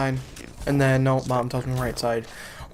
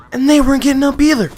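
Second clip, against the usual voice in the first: high, loud, fast complaint; indignant near-shout; exasperated outburst; 10 semitones higher, 9 dB louder, faster than usual. Real, not acted. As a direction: blurt it out in disbelief. Exasperated at family blocking the way.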